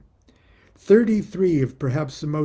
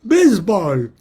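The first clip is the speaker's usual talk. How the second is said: loud excited cries of Baseball, higher; mock foreign shout; 6 semitones higher, 9 dB louder than usual. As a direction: shout it like a bishop who speaks no English. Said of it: "I'd slap my leg and go".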